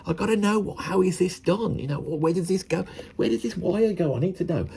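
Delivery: high, hushed, eager voice; high and urgent, itching to know; nosy scientist. Retold or imitated. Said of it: "they're driven like that".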